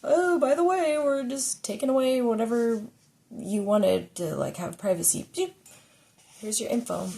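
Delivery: high, loud sugary company announcement; hammed-up cheery terms-of-service voice; mock corporate voice. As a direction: sing it out like a fake-cheery company. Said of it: "when they change their terms of service".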